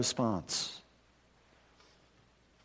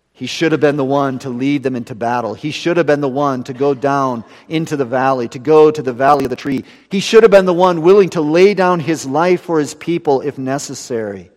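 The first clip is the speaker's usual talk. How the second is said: louder, higher pulpit preaching; preaching at full force; 4 semitones higher, 10 dB louder than usual. Acted. Preacher telling the church what Saul should have done, louder. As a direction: drive home Saul's failure.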